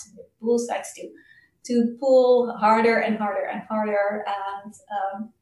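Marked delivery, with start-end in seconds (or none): none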